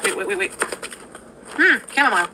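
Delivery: hiccuping between words; hiccups. Real, not acted. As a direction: talk through hiccups. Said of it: "I have hiccups".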